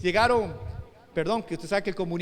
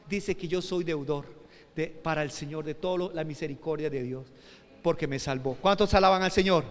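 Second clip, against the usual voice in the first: ordinary talk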